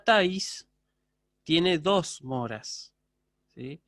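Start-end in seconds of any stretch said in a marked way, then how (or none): 1.4-2.9 tiene dos moras spoken slowly with weight on dos; slow stress on dos. Shown as a demonstration. Teacher to class, stressing dos moras slowly. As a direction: lean on dos and take your time. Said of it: "teacher stressing mora count".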